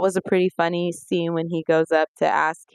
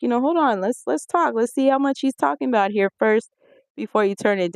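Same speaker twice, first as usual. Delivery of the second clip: lightly voiced wife; 6 semitones higher than usual. Wife voiced in retold scene.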